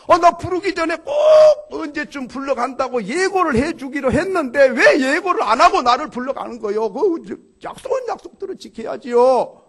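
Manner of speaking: 높고 크게 따지는 목소리; 약속 안 지켰다고 언성을 높여라; 억울한 항의. Acted. 젊은이가 염라대왕에게 따짐. (젊은이가 염라대왕에게 억울하다고 소리쳐 따짐)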